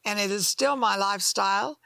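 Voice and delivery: ordinary talk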